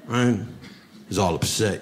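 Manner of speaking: normal talking voice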